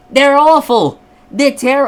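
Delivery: high, loud mock outrage; outraged complaint. Complainer to card companies about games, outraged. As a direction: cry out in exaggerated indignation.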